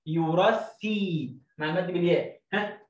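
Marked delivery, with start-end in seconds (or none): 0.1-1.4 يرثي drawn out very slow; teacher stretching يرثي; slow blending. Shown as a demonstration. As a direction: ease يرثي out slowly. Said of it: "يو لوحدها".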